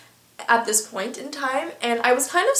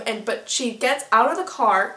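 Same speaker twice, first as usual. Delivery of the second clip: ordinary delivery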